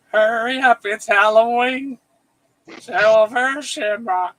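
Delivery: loud, high ad-jingle callout; Silver Shamrock jingle. Retold or imitated. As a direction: belt it like a creepy TV ad. Quoting the Halloween III jingle.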